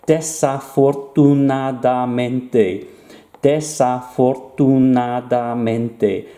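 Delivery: slow, syllable-by-syllable "desafortunadamente"; drawn-out long word. Shown as a demonstration. Teacher showing learners a very long word, slow and drawn-out. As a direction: stretch "desafortunadamente" out evenly, each syllable clear. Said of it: "this very long word".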